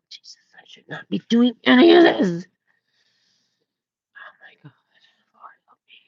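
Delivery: hushed guilty whisper. Real, not acted. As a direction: whisper it as if you could get caught. Confessing guilty smoking to herself.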